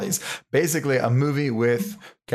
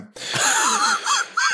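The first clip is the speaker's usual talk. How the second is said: high laugh. Real, not acted. Laughs at own joke.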